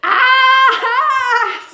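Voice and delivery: very high, loud scream; shriek. Acted. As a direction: scream in fright. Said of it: "shrieked Stanley".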